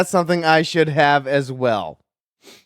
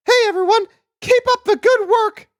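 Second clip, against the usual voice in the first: very high, chirpy squeak; high character voice; 3 dB louder than usual. Acted. Emma greets the crime scene workers, high chirpy call. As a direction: call out brightly in a tiny high voice. Voicing Emma calling to coworkers.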